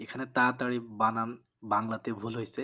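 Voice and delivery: usual speaking voice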